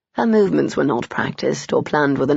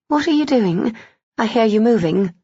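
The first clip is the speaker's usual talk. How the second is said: higher female voice; 5 semitones higher than usual. A narrator's reading. Heroine curious and unsettled.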